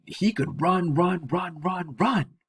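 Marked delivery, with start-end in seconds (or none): none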